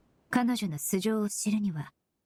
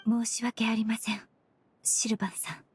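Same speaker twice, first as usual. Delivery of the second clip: hushed apology. Acted. Detective telling grieving brother he is dead, hushed. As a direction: gently break the bad news under her breath. Breaking news of death softly.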